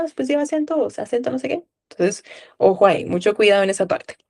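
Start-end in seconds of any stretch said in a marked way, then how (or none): 0.0-1.6 lightly voiced confused student, a bit higher; mock confused student. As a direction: imitate a confused pupil, half-hearted. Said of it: "a veces lo confundimos". (imitando a un alumno confundido, con ligereza)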